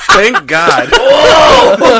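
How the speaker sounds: high, loud helpless laughter through the words; laughing shout. Real, not acted. Friend reacting to a mate's jump, laughing shout. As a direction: shout it through real cackles.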